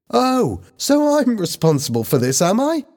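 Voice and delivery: high-pitched offended retort; indignant cry. A narrator's reading. Lisa rejects the blame, indignant cry. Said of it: "Lisa cried".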